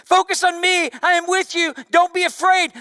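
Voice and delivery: Jesus calling to frightened disciples; calling out loud. Acted. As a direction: call out courage over the wind. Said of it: "Jesus speaks louder than anything else".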